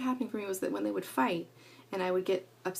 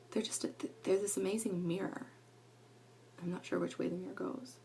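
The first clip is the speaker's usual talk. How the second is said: whisper; 3 semitones lower, 8 dB quieter than usual. Real, not acted. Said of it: "whispered aside".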